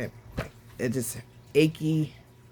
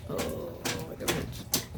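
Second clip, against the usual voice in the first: very soft, voiceless murmur; sore throat; 10 dB quieter, slower than usual. Real, not acted. Patient after surgery noting her throat hurts, faint and sore. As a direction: barely voice it, sparing a sore throat.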